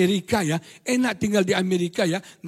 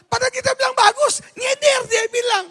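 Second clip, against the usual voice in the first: very high, loud comic outburst; hammed-up high-pitched exasperation; exasperated protest; 14 semitones higher, 5 dB louder than usual. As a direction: squeal it in disbelief at the unfair accusation. Exasperated husband protests being misread.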